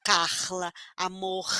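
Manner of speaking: Rio throaty R in Porta, Carla, amor; Rio accent. Shown as a demonstration. Teacher modelling for Rio children, Rio accent. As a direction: end each syllable on a Rio guttural R. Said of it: "do final da sílaba".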